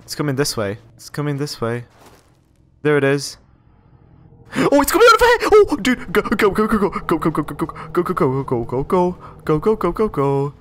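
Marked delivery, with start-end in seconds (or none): 4.5-6.1 high, loud shriek of alarm; real startled panic, very high-pitched; panicked scream. Real, not acted. Startled by monster approaching in game. 6.1-10.5 rapid-fire chant while fleeing; breathless escape panic; panicked urging. Real, not acted. Fleeing the monster in game.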